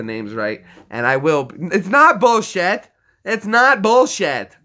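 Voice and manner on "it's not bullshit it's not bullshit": louder, higher insistence; playfully indignant protest; insistent. Acted. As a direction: insist it's not bullshit, half joking. Mock argument with player.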